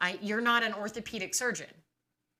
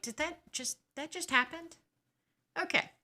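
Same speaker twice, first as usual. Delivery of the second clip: much higher, incredulous squeak; jaw-dropped and pitched up; stunned disbelief; 8 semitones higher, 3 dB quieter than usual. Retold or imitated. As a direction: ask in high, stunned disbelief. Stunned after doctor storms out.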